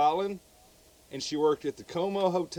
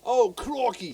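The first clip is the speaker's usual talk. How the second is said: hammed-up Australian, pitched up; mock Australian "crikey", higher; mock Australian accent; 5 semitones higher, 4 dB louder than usual. Shown as a demonstration. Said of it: "you always gotta be like".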